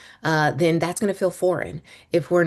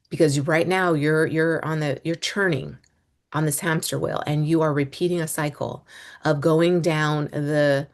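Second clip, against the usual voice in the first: ordinary talk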